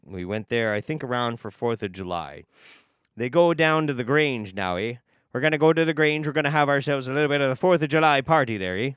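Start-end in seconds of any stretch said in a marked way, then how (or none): 3.2-8.9 put-on lilting Canadian-style small-town accent; Canadian-style relatives' accent. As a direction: talk like a northern Yooper. Imitates relatives' talk in his story.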